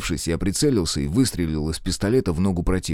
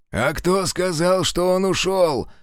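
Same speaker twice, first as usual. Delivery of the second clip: smirking; 10 semitones higher, slower than usual. Acted. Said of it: "усмехнулся раненый".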